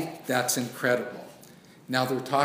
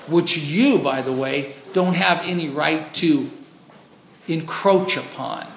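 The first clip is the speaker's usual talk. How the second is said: louder, driving pulpit voice; fiery preaching; 5 dB louder than usual. Acted. Preacher scolding mega church teachers, loud and forceful. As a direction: hammer the truth home to the congregation.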